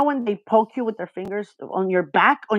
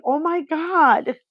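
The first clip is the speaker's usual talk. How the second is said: retold exasperation; 6 semitones higher than usual. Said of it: "I'll be freaking out".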